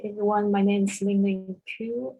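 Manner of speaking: ordinary talk, nothing marked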